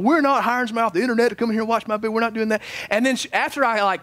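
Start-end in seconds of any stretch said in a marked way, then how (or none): 0.0-2.6 rapid-fire, heated refusal; lost it. Retold or imitated. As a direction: rattle it off fast like you've lost your temper. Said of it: "I just lost".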